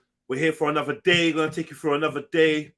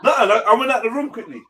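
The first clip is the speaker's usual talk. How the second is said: laughing; 5 semitones higher, 7 dB louder than usual. Real, not acted. Laughing excuse.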